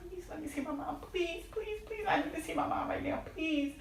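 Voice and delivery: desperate whispered begging rising to a high cry; tearful pleading. Retold or imitated. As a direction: beg through tears to see your mom. Begging to see dying mother.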